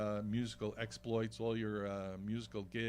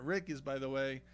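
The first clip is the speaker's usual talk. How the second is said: ordinary delivery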